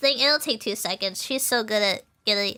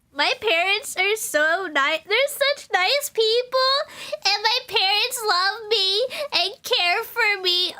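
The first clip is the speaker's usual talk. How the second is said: slow, higher voice on the edge of tears; tearful; 8 semitones higher, slower than usual. Real, not acted. Streamer tearing up telling chat about her loving parents, tearful. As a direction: let the tears rise slowly as you speak. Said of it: "Oh, why am I crying?".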